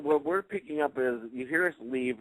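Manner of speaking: normal talking voice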